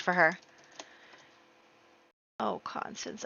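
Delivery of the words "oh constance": dismayed whisper. Real, not acted. Player whispering in shock as Constance falls. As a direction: mourn her under your breath.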